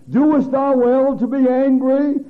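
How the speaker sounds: loud, high, slow rebuke; rebuking God. Acted. God rebuking angry Jonah, loud and high. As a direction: thunder the question down at Jonah.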